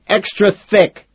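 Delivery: high, loud, drawn-out demand; furious shout. A narrator's reading. Furious add-on to roared order.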